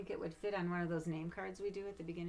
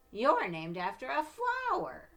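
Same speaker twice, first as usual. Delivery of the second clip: higher, louder, slow sneer; taunting; 7 semitones higher, 10 dB louder, slower than usual. Acted. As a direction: rub it in. Victoria taunting.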